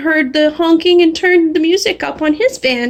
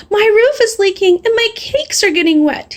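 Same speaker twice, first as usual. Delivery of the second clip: moaning baker; 7 semitones higher than usual. Acted. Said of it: "he moaned".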